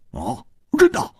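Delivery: mild astonishment; puzzled surprise. A narrator's reading. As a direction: react with a curious "oh, really". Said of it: "他奇怪地说".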